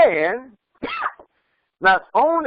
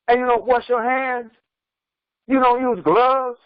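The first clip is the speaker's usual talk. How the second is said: raised, insistent preaching voice; preaching emphasis; 3 semitones higher than usual. Acted. Preacher scolding mourners about unwashed hands, raised and insistent. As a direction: preach it high to drive the point home. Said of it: "I talk like this to put emphasis on it".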